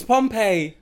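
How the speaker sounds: Pompeii as "pom-PAY"; standard pronunciation. Shown as a demonstration. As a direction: end Pompeii on a clear "ay". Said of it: "Pompeii, fam, trust me".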